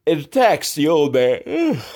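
said through a yawn; yawning mid-sentence. Real, not acted. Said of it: "Sorry for that yawn".

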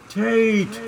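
high loud call of the name; calling out to Tate on the ship, loud; shouted name. A narrator's reading. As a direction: yell Tate across the water. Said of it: "I shouted".

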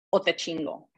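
ordinary talk

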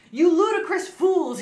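high, loud, slow mock shout. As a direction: bellow it like an angry headmaster. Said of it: "he shouted".

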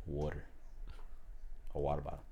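low, easy East Coast "water"; "water" tossed off low with a soft middle t. Shown as a demonstration. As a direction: say "water" the Jersey way, casually. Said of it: "the easiest way to know someone's from the East Coast".

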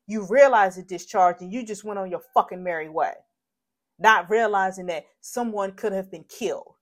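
real heated indignation; angry scolding. Real, not acted. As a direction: berate them for their carelessness. Berating the unknown shooter.

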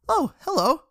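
high-pitched, lilting hello; pleasant greeting. A narrator's reading. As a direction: greet a friend, voice lifted high. Melly greets Richard after smiling.

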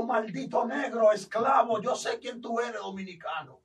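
snarling demon shouting insults; hammed-up demonic yell. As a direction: spit it out as a hateful demon. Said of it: "Me dijo".

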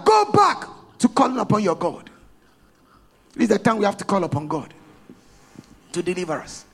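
normal talking voice

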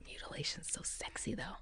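unvoiced whispered tease. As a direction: whisper it like a naughty secret. Joking aside.

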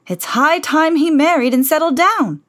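high, loud blustering king voice; fussy old king. Acted. King frets to the Grand Duke, high and loud. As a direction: play a worked-up little king. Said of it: "he told the Grand Duke".

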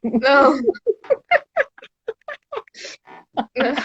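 choking and coughing out words; sputtering while choking on a drink. Real, not acted. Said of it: "eu me engasguei aqui".

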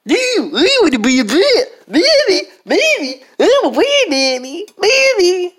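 high silly brat chant; bratty kid voice. Acted. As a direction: whine it like a spoiled kid.

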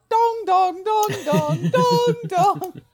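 high, loud dong calls; shouted bell chime. Shown as a demonstration. Reading the bell-ringer's part, a loud high shout. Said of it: "someone gets to shout".

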